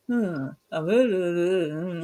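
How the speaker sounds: slow, drawn-out unbothered humming; calm ghost hunter. Retold or imitated. Mimicking the calm ghost hunter in a haunted place, slow and unbothered. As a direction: hum it out lazily like nothing scares you. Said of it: "and he'll be like".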